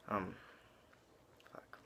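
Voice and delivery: ordinary talk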